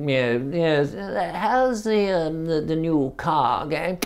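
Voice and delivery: mock toff making idle conversation; posh smoker. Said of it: "people were smoking there like".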